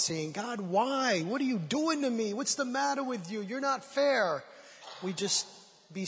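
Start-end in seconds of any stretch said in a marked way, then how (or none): 0.3-4.4 high, pleading complaint at God, hammed up a little; mock yelling at God. Congregation member shouting at God in mock complaint. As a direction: act out shouting why at heaven. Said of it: "Instead of yelling at God at the top of our lungs".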